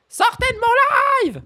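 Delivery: mock shout. Kicking out chat trolls.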